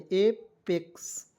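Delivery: एपेक्स drawn out very slowly, ए as in ape; एपेक्स spelled out syllable by syllable; slow demo. Shown as a demonstration. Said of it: "ए के रूप में उच्चारण करें". (एपेक्स बहुत धीरे से)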